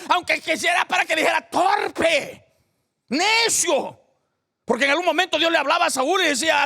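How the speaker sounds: high, loud preacher's shout; fiery preaching. Real, not acted. Pastor rousing the congregation, loud fiery preaching.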